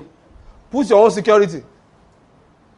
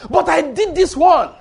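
pleading believer; 11 semitones higher, 5 dB louder than usual. Retold or imitated. Believer pleading his good works.

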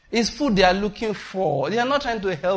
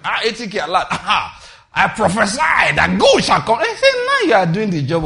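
loud, laughing, hammed-up prophecy; loud mocking prophecy; mock prophet shout; 4 semitones higher, 10 dB louder than usual. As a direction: ham up a greedy prophet. Mocking prophet accepting money.

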